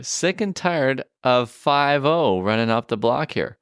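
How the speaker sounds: slow, deliberate lyric recital; reading the lyric. Retold or imitated. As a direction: read it out slowly like a quoted line. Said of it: "I can translate some of this".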